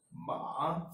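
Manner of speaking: ma with a dip and a rise; third tone. Shown as a demonstration. Teacher illustrating the fall and rise, third-tone ma. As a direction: let ma sink, then climb. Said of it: "first falls and then rises".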